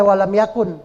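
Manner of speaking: "yakun" ending on an audible n; forbidden clear N. Shown as a demonstration. Teacher showing the wrong reading, "yakun" with n. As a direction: say "walam yakun" with the n left unmerged. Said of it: "nggak boleh".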